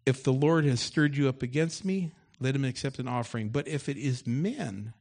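loud preaching read of the story; loud scripture reading. Acted. Pastor reads David's taunt aloud to the congregation, loud and forceful. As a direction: boom the scripture out to the church.

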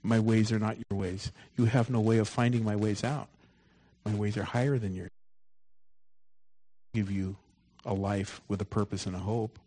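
soft, hushed voice of God; gentle whisper. Acted. Preacher voicing God gently to the congregation, soft and hushed. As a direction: speak it gently, barely above a breath. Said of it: "God gently says in a whisper".

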